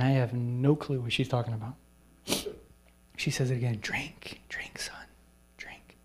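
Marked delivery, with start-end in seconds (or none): 3.8-6.0 breathy whisper fading to almost nothing; hushed whisper, trailing away; whispered prayer. Retold or imitated. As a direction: whisper it like a frail old woman praying. Old lady praying over him.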